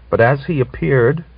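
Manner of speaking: ordinary delivery, nothing marked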